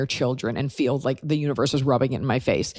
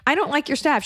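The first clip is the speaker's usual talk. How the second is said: 1 mock high complaint; 12 semitones higher than usual. Mock-quoting her own complaint.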